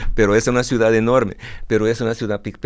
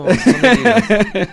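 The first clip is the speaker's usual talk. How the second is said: laughing; 13 semitones higher, 5 dB louder than usual. Real, not acted. Laughs as time runs out.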